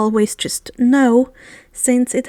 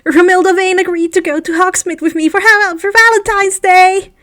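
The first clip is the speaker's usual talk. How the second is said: fast, higher and louder, crowing with joy; crowing celebration; 6 semitones higher, 6 dB louder than usual. A narrator's reading. Colin announcing his Valentine's date, crowing with joy. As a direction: crow the news out like a victory. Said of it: "Colin called out with a crow of celebration".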